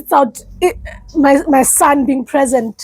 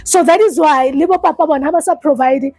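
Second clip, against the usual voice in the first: normal talking voice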